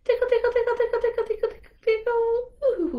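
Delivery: high playful tickle chant; sing-song tickle, high up; high teasing tickle-tickle; playful tickling. Acted.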